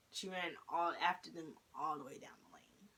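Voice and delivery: hushed whisper all through. Real, not acted. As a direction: whisper it like a secret. Hushed retelling of the chase.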